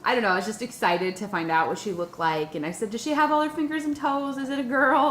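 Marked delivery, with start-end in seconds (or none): 2.9-5.1 anxious questions. Retold or imitated. Anxious questions about baby.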